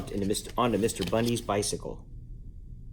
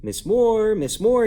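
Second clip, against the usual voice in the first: calling a name through the house, louder; 10 semitones higher, 6 dB louder, slower than usual. A narrator's reading. Mister Bundy calls for Miss Moore, raised calling voice. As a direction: call out to her, raised and high. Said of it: "he called".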